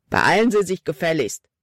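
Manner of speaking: gereizter Mann, drängend; ungeduldige Beschwerde. A narrator's reading. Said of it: "beschwerte sich Riggs". (ungeduldig gereizte Männerstimme)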